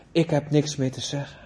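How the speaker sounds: usual speaking voice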